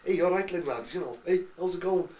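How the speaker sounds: high, loud Scouse greeting; Scouse accent. Retold or imitated. Greeting the lads back in Liverpool, Scouse accent. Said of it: "when I was at Liverpool".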